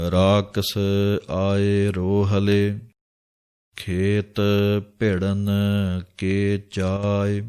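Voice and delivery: slow, drawn-out chanting of the verse; scripture recitation. Acted. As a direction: intone each word slowly like a reading of Gurbani. (ਹੌਲੀ ਹੌਲੀ ਬਾਣੀ ਦਾ ਪਾਠ)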